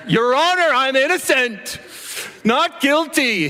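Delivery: mock-grand pleading up high; melodramatic plea. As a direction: play the wronged innocent to the judge, overblown. Mock courtroom plea, retold.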